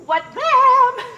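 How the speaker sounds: loud, high sing-out for someone out of sight; calling out. Acted. Nurse summoning Juliet to her mother, calling out. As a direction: call the girl in, high and drawn out.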